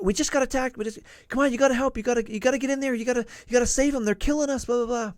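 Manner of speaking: high, urgent, loud begging for help; high panicked yelling for help; panicked pleading. Retold or imitated. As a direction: plead frantically like someone fleeing an attack. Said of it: "they're screaming, like, panic".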